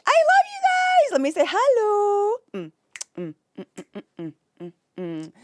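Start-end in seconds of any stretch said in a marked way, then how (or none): 0.0-2.4 gushing. Real, not acted. Streamer gushes to Twitch chat, high and delighted. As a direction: burst out with affection.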